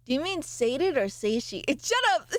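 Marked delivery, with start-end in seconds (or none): 1.8-2.3 high mock shout; playful shout. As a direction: snap back jokingly at chat. Playful retort to chat.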